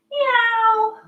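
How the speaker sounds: high squeaky cat meow. Pretend. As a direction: meow like a playful kitten. Kids yoga cat cow sounds.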